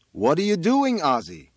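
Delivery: loud, high question falling away; mother calling up. A narrator's reading. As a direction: call out in bewildered dismay. Mother calls up to son.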